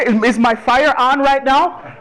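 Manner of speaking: puzzled Caribbean mom asking back; a light Caribbean lilt, confused; Caribbean twang. Retold or imitated. Son quizzing his Caribbean mom on slang, light Caribbean twang. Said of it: "in her Caribbean twang".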